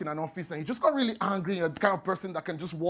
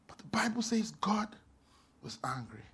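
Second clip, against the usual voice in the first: hushed; 8 dB quieter than usual. Acted. Whispered solemn line.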